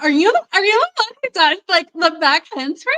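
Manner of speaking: high, fast, eager gushing; excited. Retold or imitated. Friend Angel eagerly asking about back handsprings. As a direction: rattle it off like an excited teen. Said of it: "So she was like".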